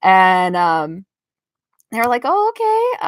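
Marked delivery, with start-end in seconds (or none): 2.2-3.1 surprised. Retold or imitated. Family surprised, retold.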